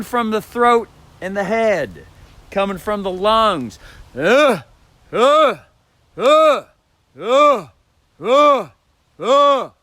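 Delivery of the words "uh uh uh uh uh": high, loud roaring grunts; loud lion-like "uh" roars. Shown as a demonstration. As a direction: roar like a big cat from the lungs. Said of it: "how lions and big cats ... roar".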